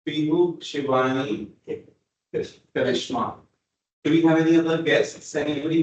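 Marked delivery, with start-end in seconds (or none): none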